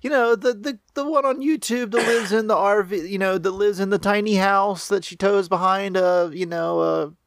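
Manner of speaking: an excited coworker gushing about a YouTuber; lightly voiced coworker. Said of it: "She's like".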